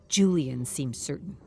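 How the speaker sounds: ordinary delivery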